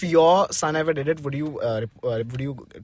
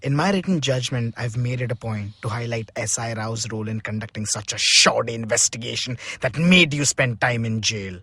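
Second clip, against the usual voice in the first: lower, softer, measured judge voice; judge's verdict; 8 semitones lower, 5 dB quieter than usual. Pretend. Friend plays the judge, softly pronouncing the verdict. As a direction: read the verdict like a kindly judge. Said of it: "I'm the judge".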